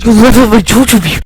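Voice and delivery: loud high shouted opener. Acted. Friends goofing, meme shout opener. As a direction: yell it out like a meme.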